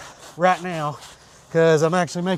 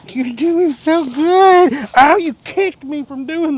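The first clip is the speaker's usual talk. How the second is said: higher, louder, slow gushing praise; 8 semitones higher, 6 dB louder, slower than usual. Real, not acted. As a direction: gush over the dog, loud and slow. Praising dog in baby talk.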